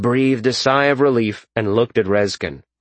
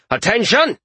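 shouted command; 12 semitones higher, 3 dB louder than usual. A narrator's reading. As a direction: call troops sharply to order. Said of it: "he shouted".

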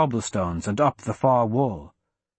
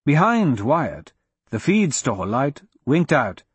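ordinary delivery

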